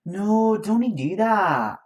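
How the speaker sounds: Scottish accent practice line. Shown as a demonstration. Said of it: "my go-to to get into an accent".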